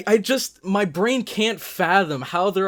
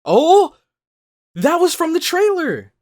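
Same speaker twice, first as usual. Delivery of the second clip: high and loud, sudden delight; excited recognition; 12 semitones higher, 9 dB louder than usual. Real, not acted. Viewer recognizes a line from the trailer, loud excited cry. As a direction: spot the quote you already know.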